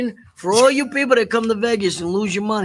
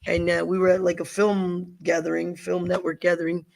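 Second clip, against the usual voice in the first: ordinary talk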